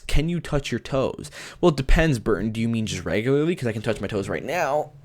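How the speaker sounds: ordinary talk, nothing marked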